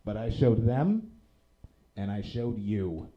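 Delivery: ordinary talk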